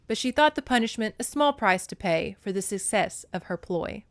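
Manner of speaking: ordinary talk, nothing marked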